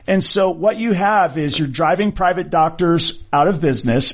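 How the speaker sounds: ordinary talk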